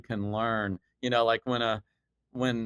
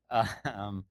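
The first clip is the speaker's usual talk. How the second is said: ordinary talk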